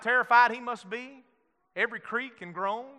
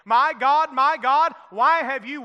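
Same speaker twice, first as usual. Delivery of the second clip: louder, higher anguished cry; cried out; 4 semitones higher, 7 dB louder than usual. Acted. Preacher voicing Jesus on the cross, a loud anguished cry. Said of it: "Jesus cried out".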